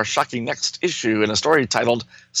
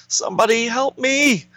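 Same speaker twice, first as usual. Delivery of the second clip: panicked hero; 12 semitones higher than usual. Acted.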